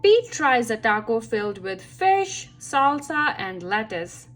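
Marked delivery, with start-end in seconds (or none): none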